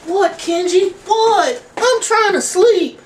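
very high squeaky cartoon bear; high squeaky puppet bear woken from sleep; sleepy teddy bear voice. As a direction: squeak like a groggy stuffed bear. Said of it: "It's Dab the AIDS Bear here".

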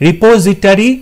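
"রিপোজিটরি" ending in an open "ta-ri"; "-tari" ending. Shown as a demonstration. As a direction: sound out "রিপোজিটরি" as ri-po-zi-TA-ri. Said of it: "option A তে যেটা আছে".

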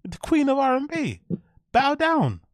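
loud, high hype-man call; excited hype. Real, not acted. Streamer hyping up a singer, loud and high. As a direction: announce her like royalty.

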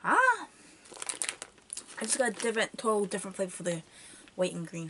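talking through sour candy chew; chewing while talking. Real, not acted. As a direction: mouth partly full of candy. Seen 1: Grimacing, lips working as if chewing; candy eaten earlier, worm held.

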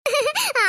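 high, short laugh; brief high chuckle before replying; light staged laughter; acted laugh.

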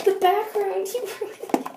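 mock accusing. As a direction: tease like a dramatic accusation. Teasing cousin about mistake.

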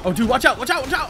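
loud alarmed warning shout; panicked yelling. Real, not acted. Gamer yelling at the boat captain to watch out. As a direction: yell a frantic warning. Yelling at drowning game character.

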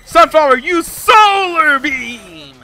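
loud, high shout with drawn-out last words; mock anime-style attack yell; attack-name battle cry. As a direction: call out your special move, stretching it long. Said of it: "I should just say".

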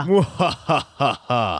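evil laugh. Pretend. Gloating at the rival.

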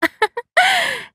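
high, light giggle; short giggle falling away; sheepish laugh. Acted. Laughing before saying sorry to Master, sheepish giggle.

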